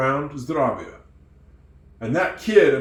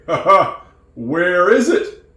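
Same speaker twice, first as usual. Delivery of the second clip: mock angry demand; 4 semitones higher, 7 dB louder, slower than usual. Owner demands his bicycle back.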